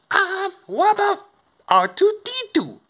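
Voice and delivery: very high, squeaky suggestion; high-pitched character voice. Acted. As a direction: pipe up with an idea in a tiny high voice. Character pipes up with idea.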